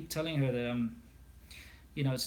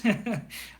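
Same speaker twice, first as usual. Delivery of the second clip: laugh; 7 semitones higher, 5 dB louder than usual. Real, not acted. Laughing before apology to Australians.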